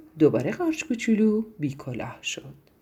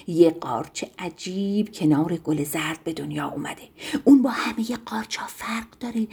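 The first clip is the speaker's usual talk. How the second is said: secretive breathy whisper; gossipy whisper; 3 semitones lower than usual. Acted. Dove whispering gossip to the garden, hushed. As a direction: lean in and whisper the news.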